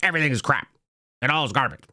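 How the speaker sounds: lightly put-on angry ranter voice; a hint of a grumbling ranter; Mark Levin. Mocking himself as the guy who just complains, lightly put-on rant. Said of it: "I could sound like Mark Levin".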